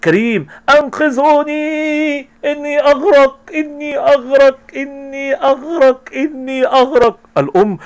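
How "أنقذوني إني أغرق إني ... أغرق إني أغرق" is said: mock drowning cries. Karim drowning, calling out.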